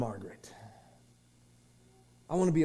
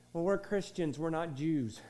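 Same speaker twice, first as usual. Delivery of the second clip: ordinary talk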